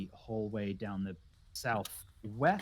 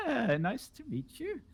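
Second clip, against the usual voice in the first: high, wavering polite greeting; mildly startled, higher-pitched hello; a little shocked; 10 semitones higher, 5 dB quieter than usual. Acted. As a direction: greet them while slightly taken aback. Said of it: "she's a little bit shocked".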